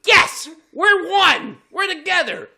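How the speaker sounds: hammed-up joy; ecstatic fan. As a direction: gush it out like a starstruck fan. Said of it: "So you're like".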